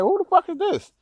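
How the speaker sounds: high, incredulous who-is-this; shocked at being yelled at; startled. Retold or imitated. Trucker startled by voice below, high disbelief.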